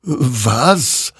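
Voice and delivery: puzzled surprise. A narrator's reading. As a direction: ask it in baffled surprise. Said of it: "wunderte sich dieser".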